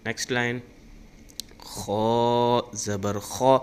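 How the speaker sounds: loud, slow, drawn-out chanted syllables; Qaida recitation drill. Shown as a demonstration. Teacher drilling students in hamza vowels, loud chant. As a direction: call each syllable out for pupils to repeat.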